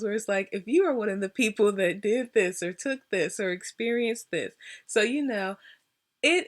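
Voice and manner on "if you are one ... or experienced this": usual speaking voice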